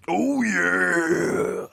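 high raspy "Oh yeah" growl; wrestling promo. Shown as a demonstration. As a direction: bellow it like Macho Man. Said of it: "affect a voice close to Macho Man".

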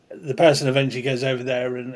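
ordinary delivery

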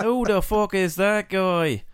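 slow, high mock Irish brawler; a bad, hammy Conor McGregor Irish accent; Conor McGregor impression. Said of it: "a really poor Conor McGregor".

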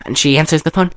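ordinary delivery

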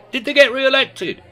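very high, loud questioning voice; high party guest voice. A narrator's reading. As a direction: pipe up eagerly.

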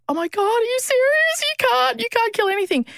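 high, shocked squeal of disbelief; you can't be serious, way up high. Retold or imitated. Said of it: "And I was like".

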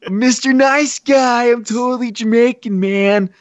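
high, laughing fake Jamaican; mock Jamaican accent. Brian insisting he is Jamaican, badly faked Jamaican accent. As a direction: draw it out as a bad Jamaican impostor. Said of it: "was talking like that the entire time".

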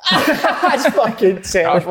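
words squeezed out through laughter; laughing. Real, not acted. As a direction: laugh while you say it. Mocking friend's bad accent.

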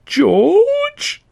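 high, drawn-out wailing cry of the name; melodramatic wail. Acted. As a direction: wail it like a stage tragedy. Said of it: "let out a melodramatic wail".

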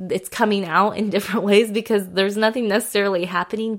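normal talking voice

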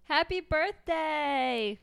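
high and slow, syrupy; slow sweet greeting. Acted. Heidi wishes Frankie happy birthday, slow and sugary. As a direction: draw it out sweetly. Acted scene at a party.

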